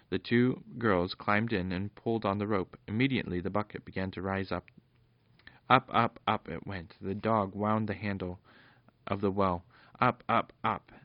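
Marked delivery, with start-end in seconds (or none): none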